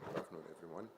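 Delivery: normal talking voice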